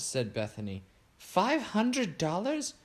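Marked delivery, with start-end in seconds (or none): 1.2-2.7 high, slow, stretched-out gasp of a question; shocked disbelief. Acted. Bethany stunned at Fiona's deodorant bill, high incredulous stretch. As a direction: let the price floor her, drawn out and high.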